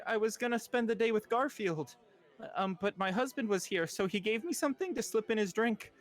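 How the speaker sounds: high, a touch of tearful confessing; teary confession. Acted. Woman admitting the poisoning to the doctor, mildly teary. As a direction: confess it with held-back tears. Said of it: "her eyes kinda tear up and she says".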